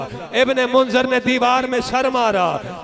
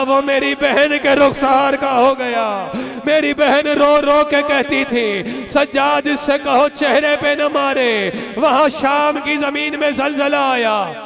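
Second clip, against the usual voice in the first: wailing majlis climax; 3 semitones higher, 4 dB louder than usual. Acted. Preacher driving the mourners to lament, loud and wailing. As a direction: preach the grief at full force.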